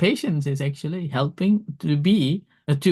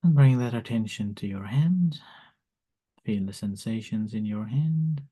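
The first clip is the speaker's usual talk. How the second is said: guided meditation; 3 semitones lower, 5 dB quieter than usual. Acted. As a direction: lead them gently into calm. Leads loving kindness meditation.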